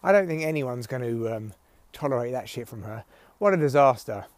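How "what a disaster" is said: ordinary talk, nothing marked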